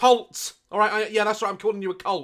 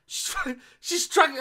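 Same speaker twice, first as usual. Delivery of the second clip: ordinary talk, nothing marked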